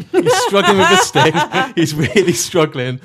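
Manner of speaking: high and loud, laughing through the words; can't stop laughing at him; mocking the limping host through real laughter. Real, not acted. Laughing at Wonka's limping entrance.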